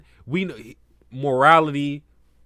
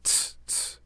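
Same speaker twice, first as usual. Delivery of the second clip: normal talking voice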